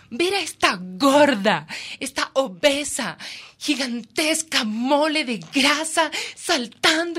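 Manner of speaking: slow, high, awed. Real, not acted. Reporter describing the huge whale, slow awed high voice. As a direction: stretch every word out in wonder.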